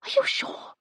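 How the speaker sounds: disbelieving whispered question. A narrator's reading. Phoebe doubting the name she heard, whispering. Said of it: "she whispered".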